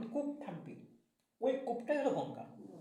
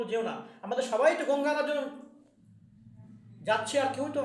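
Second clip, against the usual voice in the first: pleading wife; 6 semitones higher, 6 dB louder than usual. Acted.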